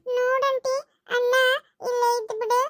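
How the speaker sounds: put-on whiny, crying child's voice; tearful complaining. Little girl complaining to auntie about brother, tearful. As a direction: tell tales through tears. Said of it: "ಅಳ್ಬೇಡ ಸುಮ್ನಾಗ".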